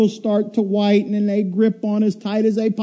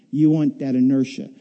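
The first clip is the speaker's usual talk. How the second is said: ordinary delivery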